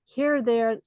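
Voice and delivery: ordinary delivery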